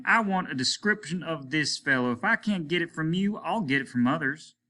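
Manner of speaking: hard-edged interrogation of the townsfolk; stern captain. Acted. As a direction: lay down the order sternly.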